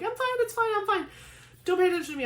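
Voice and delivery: very high mock-insistent protest; squeaky protest. Niece who wants no one's attention, squeaky protest. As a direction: squeak it out to deflect all attention. Retelling deflecting attention from herself.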